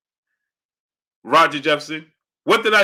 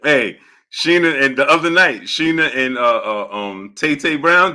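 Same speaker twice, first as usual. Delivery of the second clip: usual speaking voice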